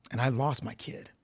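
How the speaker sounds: normal talking voice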